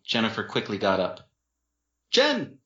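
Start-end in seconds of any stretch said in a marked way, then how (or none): 2.1-2.6 high, sudden call of a name; exclaiming. A narrator's reading. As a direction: call out to her in alarm. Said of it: "Dean exclaimed".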